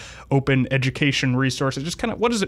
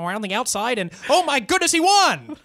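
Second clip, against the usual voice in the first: frantic mock sports commentary; 11 semitones higher than usual. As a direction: shout the finish like a race announcer. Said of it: "I wanna start announcing horse races".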